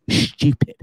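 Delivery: "Stupid" as "SHTYOO-pid", hissy start; mum's English pronunciation. Shown as a demonstration. Son demonstrating mum's word, "Stupid" as English "shtyoo-pid". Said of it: "that's how she says it".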